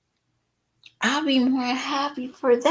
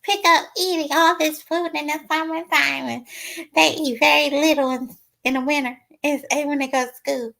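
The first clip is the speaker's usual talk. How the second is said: higher put-on voice for puzzled parents; imitating a baffled parent, pitched up; mock other parents; 6 semitones higher, faster than usual. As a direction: parody the parents who ask this. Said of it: "I always hear people say".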